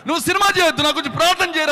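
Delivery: a sobbing friend shouting for prayer; crying plea. Retold or imitated. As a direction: beg him to pray, half shouting. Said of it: "అని ఏడుస్తా ఉన్నాడు".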